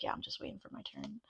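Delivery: a bored spirit waiting in line, murmured low; patient spirit guide. Pretend. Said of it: "one spirit guide just standing there".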